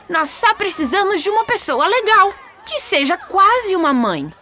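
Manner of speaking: put-on high-pitched boyish persuasion; eager Peter Pan. Peter persuades Wendy to play mother, eager high voice. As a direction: coax eagerly in a high boy's voice.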